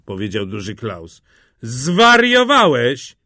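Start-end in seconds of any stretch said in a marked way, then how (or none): 1.6-3.2 loud, high, drawn-out disbelief; stretched-out scandalised exclamation; incredulous outcry. A narrator's reading. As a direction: exclaim slowly as if at a madman. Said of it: "powiedzieli chórem".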